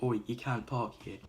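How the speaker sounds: a loud, hammed-up "Oi". Warden yelling at Big Dave's parked car: loud mock warning.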